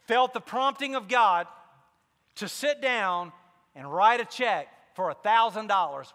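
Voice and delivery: ordinary delivery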